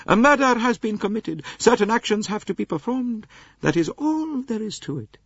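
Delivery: lofty aged princess voice; old princess. Acted. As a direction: voice the elderly princess, cool and proud. Princess voice held.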